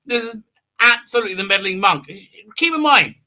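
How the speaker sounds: ordinary delivery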